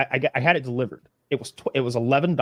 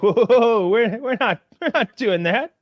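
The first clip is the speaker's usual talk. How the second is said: mock panic; 8 semitones higher than usual. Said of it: "immediately be like".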